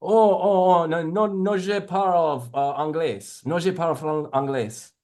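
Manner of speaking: flustered tourist in French; put-on French speaker act; non-English speaker. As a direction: plead in French, starting high and apologetic. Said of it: "acting like I don't even speak English".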